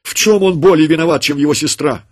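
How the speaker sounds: high, loud pleading outcry; mother's cry. A narrator's reading. Mother crying out at the soldier over her children, high and loud. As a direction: fling the question at the soldier. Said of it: "крикнула она".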